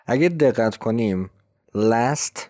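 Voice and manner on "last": "last" alone with its final t; sounded T. Shown as a demonstration. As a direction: finish "last" on a t. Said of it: "یه تی داره". («last» با تی کامل)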